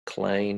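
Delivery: clean said as 'clane'; broad Australian 'clane'; Aussie clean. Shown as a demonstration. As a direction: slide the EE of clean to 'ay'. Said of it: "he uses the word clane".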